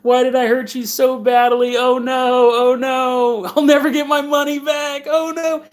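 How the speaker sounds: whiny imitation of a man crying; mock crying. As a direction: act out tearful regret, hammed up. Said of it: "he starts crying. He's like".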